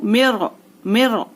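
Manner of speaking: "मिर" with a full, heavy R, drawn out slowly. Shown as a demonstration. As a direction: say "मिर" slowly, thickening the final R. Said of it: "रॉ साकिना पुर". ("मिर" की र पुर यानी मोटी, धीमे)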